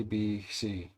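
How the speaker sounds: normal talking voice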